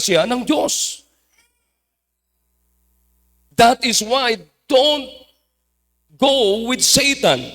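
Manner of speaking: loud, emphatic sermon delivery; fervent preaching. Real, not acted. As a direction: preach it with force, drawing out key English phrases. Preaches to the congregation.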